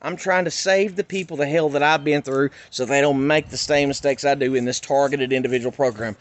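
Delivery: usual speaking voice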